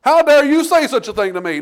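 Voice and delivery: loud, high, indignant outburst; a king who refuses the prophet's rebuke, loud and high; offended king. Retold or imitated. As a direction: bristle with wounded pride. He didn't say.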